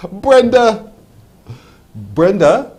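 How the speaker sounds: loud, high-pitched call of a name. Real, not acted. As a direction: hail the caller loudly by name. Calling the caller by name.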